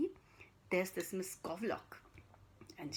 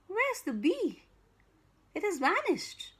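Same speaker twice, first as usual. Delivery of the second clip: higher, louder wondering; puzzled surprise; 8 semitones higher, 6 dB louder than usual. Acted. Storyteller wondering where the bee went, higher and louder. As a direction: ask it wide-eyed.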